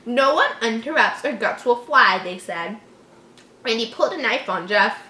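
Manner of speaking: ordinary talk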